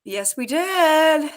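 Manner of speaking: usual speaking voice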